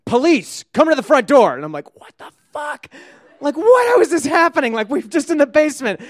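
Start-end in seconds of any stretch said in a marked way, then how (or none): 0.0-1.5 loud, raised police command; police shout. Retold or imitated. Cop calling into the house, shouted order. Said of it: "I hear this voice go". 2.0-6.0 high-pitched disbelieving freak-out; retold panic. Teenager hearing police at the door, panicked.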